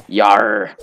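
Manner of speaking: a growled pirate yar with a rolled English R. Shown as a demonstration. Contrasting the English R with a pirate's yar. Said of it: "like in the pirates".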